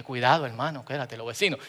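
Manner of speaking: low, very soft timid warning; under-the-breath mock concern; hushed mock caution. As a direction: mimic a worrier shushing a brother. Mimics a timid, cautious believer.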